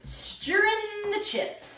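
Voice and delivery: high, slow sing-song voice; high sing-song announcing. Pretend. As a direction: stretch it out high and playful. Repeats in a put-on voice.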